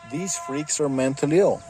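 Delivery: normal talking voice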